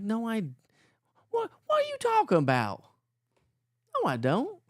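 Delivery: very high, exaggerated Southern protest; mock Southern accent. Denying to family he has an accent, mock Southern. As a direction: squeal it in a put-on Southern accent. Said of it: "You have a Southern accent now".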